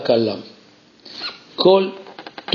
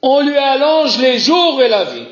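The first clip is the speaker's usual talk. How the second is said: excited proclamation; 10 semitones higher than usual. Acted. Climax of teaching point.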